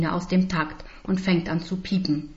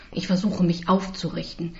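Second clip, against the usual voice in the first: usual speaking voice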